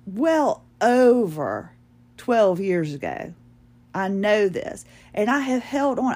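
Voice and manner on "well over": "well over" very slow and punched; "well over" hugely drawn out, falling at the end; heavily emphatic. Real, not acted. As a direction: stretch "well over" right out, swelling on "over". Stronger repeat for emphasis.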